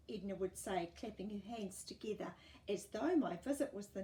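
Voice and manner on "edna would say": ordinary delivery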